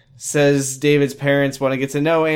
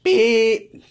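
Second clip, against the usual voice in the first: voice cracking; 9 semitones higher than usual. Real, not acted. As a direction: beep with a cracking voice. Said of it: "Sorry, my voice cracked".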